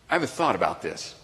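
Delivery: ordinary talk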